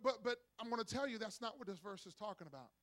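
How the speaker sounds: ordinary talk, nothing marked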